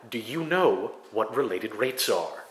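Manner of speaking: ordinary talk, nothing marked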